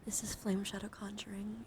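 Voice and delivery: usual speaking voice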